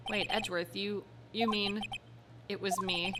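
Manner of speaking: ordinary talk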